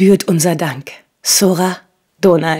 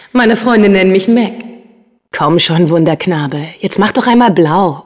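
ordinary talk, nothing marked

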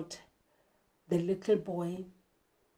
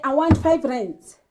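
a loud blunt "I want five rands"; child demanding money from mom, loud and rude; disrespectful demand; 4 semitones higher, 8 dB louder than usual. Pretend. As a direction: demand the money rudely, louder. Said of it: "that's disrespectful".

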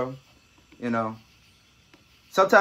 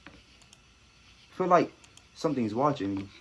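low, hushed and slow; spooked whisper; 9 semitones lower, 7 dB quieter, slower than usual. Real, not acted. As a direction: hush it, spooked. Spooked alone in woods.

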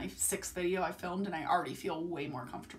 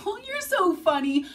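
high, louder sarcastic sing-song; mock dismissive reply; 11 semitones higher, 7 dB louder than usual. Vlogger imitating people who laugh her off, high mock sing-song. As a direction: toss it off in mock disbelief. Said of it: "it's like".

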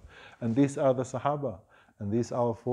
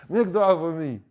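higher, louder tearful plea; pleading through tears; 5 semitones higher, 6 dB louder than usual. Retold or imitated. Abu Hurairah begs the Prophet, mock tearful plea. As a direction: beg for the prayer, half crying with joy. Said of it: "And he's crying".

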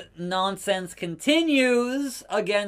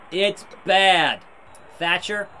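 ordinary talk, nothing marked

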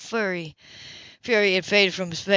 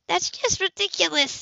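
much higher, scoffing woman; 11 semitones higher than usual. Acted. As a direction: scoff at the idea. Grace scoffs.

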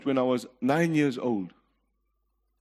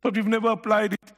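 raised, driving pulpit preaching; congregation-stirring sermon voice, raised and insistent; fiery preaching; 7 semitones higher, 4 dB louder than usual. Acted. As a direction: preach it out to the congregation, full force. Preacher building at full force.

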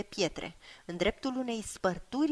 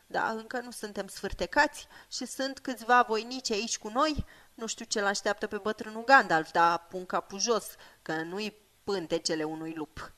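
plucky hobbit servant; 2 semitones higher than usual. Acted. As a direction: put on a brave face for a friend. Sam voiced as a plucky hobbit.

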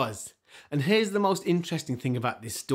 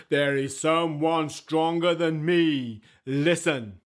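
slow, weighty giant voice; deliberate stone giant; slow statue voice; slower than usual. Acted. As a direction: statue telling the mice, slow and heavy. Statue speaking.

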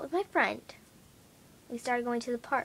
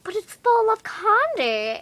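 higher, slow, louder lady voice; child imitates the lady, slow and higher; mock lady insisting; 10 semitones higher, 7 dB louder, slower than usual. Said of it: "And she's like".

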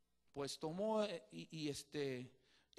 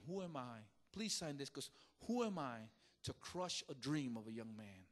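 hushed and much softer, near tears; tearful confession; 8 semitones lower, 7 dB quieter than usual. Retold or imitated. Teacher confesses to the star he once doubted, softly tearful. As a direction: let it sink to a broken hush.

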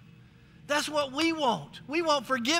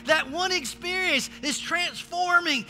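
higher and louder proclamation; preaching at full force; 3 semitones higher, 3 dB louder than usual. Real, not acted. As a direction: preach the cross to the church.

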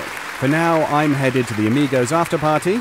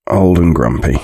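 put-on low, loud grumpy old man voice; 7 semitones lower, 6 dB louder than usual. Shown as a demonstration. Showing off a range to the cast, grumpy old man voice. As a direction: growl it like a cranky old man. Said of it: "I can do old and grumpy".

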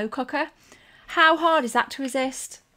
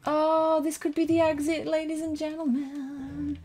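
higher, stretched-out suspense; high, slowing showman's suspense; hopeful announcing; 5 semitones higher than usual. Real, not acted. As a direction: draw it out to the audience, slowing at the end. Announces possible exit to viewers.

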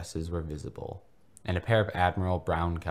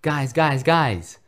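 much higher, louder gleeful calling; joyous screech; 9 semitones higher, 10 dB louder, much slower than usual. Acted. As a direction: yell to the family table in pure glee. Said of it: "Ronald screeched joyously".

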